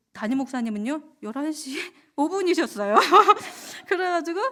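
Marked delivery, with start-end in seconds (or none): 1.2-3.0 higher, louder, breaking into laughter; laughing. Real, not acted. Repeats the eyebrow joke laughing. 3.0-3.8 high, loud burst of laughter. Real, not acted. Laughs at own joke.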